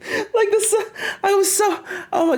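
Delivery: high-pitched laughter through words; laughing hard. Real, not acted. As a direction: laugh helplessly about the heat. Laughing about the heat.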